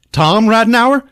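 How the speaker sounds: mock disbelief at the name; surprised question. Curator startled the dentist is Tom Radenour, high mock surprise.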